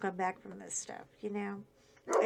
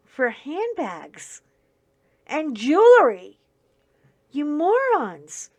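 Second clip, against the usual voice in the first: slow, louder, higher scolding; angry outburst; 5 semitones higher, 11 dB louder, slower than usual. Real, not acted. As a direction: let them have it, you morons. Scolding the burglars.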